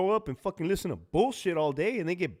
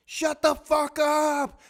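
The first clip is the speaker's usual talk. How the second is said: very high, slow strained yell; hammed-up enraged screaming; acted furious scream; 9 semitones higher, 3 dB louder, slower than usual. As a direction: drag out each word at full exasperation. Acted screaming quarrel continues.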